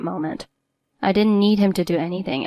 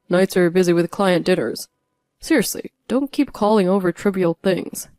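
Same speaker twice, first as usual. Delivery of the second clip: louder scolding brush-off; dismissive husband; 3 dB louder than usual. A narrator's reading. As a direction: talk down to her.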